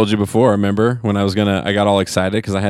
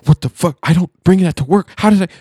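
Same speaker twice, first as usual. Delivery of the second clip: rising high, louder panic; panicked disbelief; 11 semitones higher, 4 dB louder than usual. Retold or imitated. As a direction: climb higher with every question. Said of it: "I was like".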